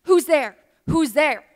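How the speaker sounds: loud, startled call into the dark. Acted. Lost old lady calls to a voice in the barn, calling out loudly. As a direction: call out sharply to an unseen stranger.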